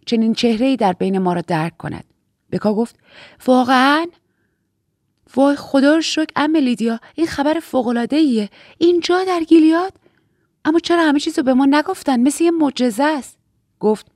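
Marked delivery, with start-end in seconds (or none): 3.5-13.4 high, amazed and thrilled; breaking into excited, high-pitched wonder; overexcited. Acted. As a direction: gush over miraculous news in a raised voice. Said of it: "لطفاً خودتون رو کنترل کنین".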